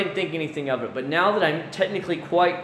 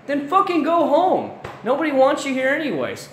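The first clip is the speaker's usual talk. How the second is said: 1 wife's retort; 11 semitones higher, 3 dB louder than usual. Retold or imitated. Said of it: "she's like".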